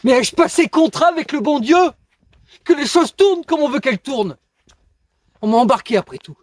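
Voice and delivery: high, loud cry of protest; indignant outburst. Acted. Man protesting his own birth to God, indignant and loud. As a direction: cry out in indignation, far above your talking pitch. Monologue, protest against being born.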